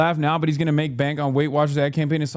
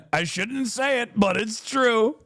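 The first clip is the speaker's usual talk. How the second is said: mock Trump, laughing; 7 semitones higher than usual. Mimicking Trump's praise clip.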